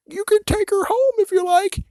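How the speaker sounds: put-on very high falsetto sweet old lady; mock grandma. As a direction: chirp it like a sweet granny. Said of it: "when the grandma's like".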